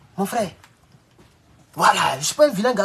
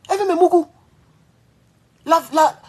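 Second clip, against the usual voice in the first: mocking tone; 10 semitones higher, 4 dB louder than usual. Mocking the landlady's advances.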